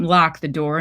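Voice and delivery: plain reading voice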